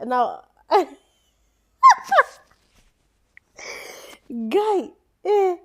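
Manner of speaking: talking through real laughter; laughing. Real, not acted. Caster trying to stop laughing at the players, laughing through words. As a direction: try to speak while still laughing. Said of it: "let me stop laughing".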